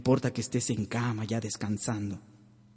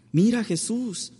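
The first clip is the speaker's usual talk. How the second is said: Mary's appeal; 10 semitones higher, 4 dB louder than usual. Retold or imitated. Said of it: "le dijo María Santísima".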